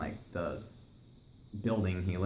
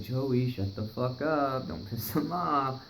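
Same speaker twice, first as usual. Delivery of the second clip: urgent friend; 4 semitones higher, slower than usual. Retold or imitated. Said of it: "Mike's like".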